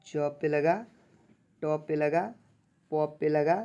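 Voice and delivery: ordinary talk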